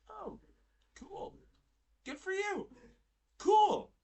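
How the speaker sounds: high-pitched, mock-pleased replies; high, spaced-out pretend enthusiasm; feigned approval. Acting out own feigned approval.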